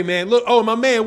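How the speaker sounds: ordinary delivery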